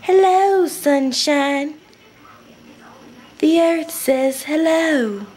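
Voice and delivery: high, slow sing-song greeting; chirpy hello, drawn out slow; imitating friend. Friend greeting her with a high slow hello. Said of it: "she was like".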